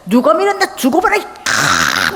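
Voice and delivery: drawn-out mock threat; acted fury. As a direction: act out someone blind with anger. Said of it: "사람이 얼마나 화가 났음".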